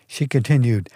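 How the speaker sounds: plain reading voice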